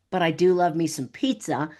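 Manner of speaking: normal talking voice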